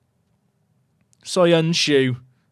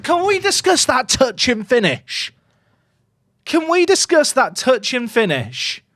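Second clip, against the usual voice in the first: higher, awed, emphatic praise; raving; 7 semitones higher than usual. Real, not acted. Streamer asking chat to admire the finish, raving. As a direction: rave about the goal to chat.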